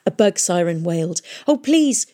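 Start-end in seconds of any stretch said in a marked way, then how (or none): 1.5-2.1 high, urgent plea; pleading cry. Acted. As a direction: beg her, frightened and loud. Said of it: "she cried".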